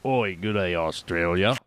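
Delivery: a tentative try at an Australian accent. Shown as a demonstration. Guest greeting Australian listeners, trying an Australian accent. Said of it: "Did I say it right?".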